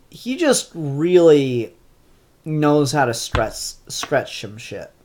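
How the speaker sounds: ordinary talk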